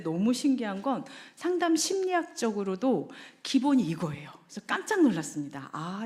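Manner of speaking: ordinary delivery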